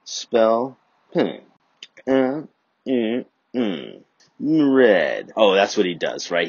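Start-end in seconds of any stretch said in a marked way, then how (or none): 0.0-5.3 mock struggling kid. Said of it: "He spells".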